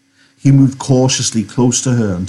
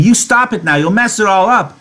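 much higher, indignant outburst; angry cry; 11 semitones higher than usual. Acted. Curly's wife ordering Lenny to stop, crying angrily. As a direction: cry out sharply, pitch leaping up. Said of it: "then she cried angrily".

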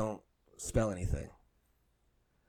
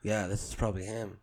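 ordinary talk, nothing marked

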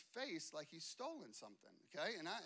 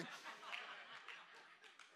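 soft breathy laugh; 9 dB quieter than usual. Real, not acted. Laughs at own story.

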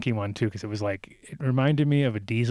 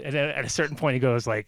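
usual speaking voice

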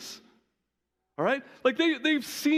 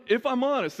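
usual speaking voice

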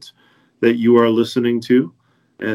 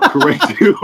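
laughing; 9 semitones higher, 5 dB louder than usual. Real, not acted. Laughs at own awkwardness.

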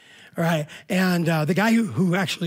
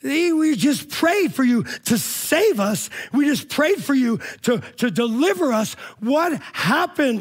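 raised, slow, stunned cry at God; anguished protest; 9 semitones higher, 4 dB louder, slower than usual. Retold or imitated. Survivor finding his group dead, raised stunned protest to God. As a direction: demand an answer through shock. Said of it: "And he started asking God".

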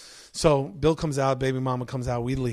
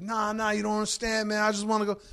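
put-on higher whiny drunk plea; drunk pleading; 5 semitones higher than usual. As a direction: beg to be let go. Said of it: "his brother's like".

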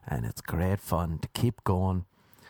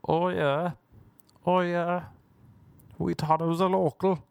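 lightly higher hammed-up Irish accent; 8 semitones higher than usual. Friends in the back seat chime in, hammed-up Irish accent. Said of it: "Doing their Irish accents".

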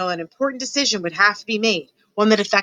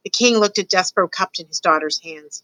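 ordinary delivery, nothing marked

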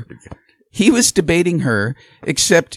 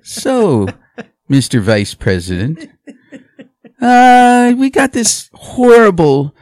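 loud, slow, hammed-up softball question; mock fawning moderator; 2 semitones higher, 6 dB louder, slower than usual. Mocking Stephanopoulos lobbing Biden an easy one, loud and slow. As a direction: drag out horrible like a sycophant. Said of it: "Where George Stephanopoulos is".

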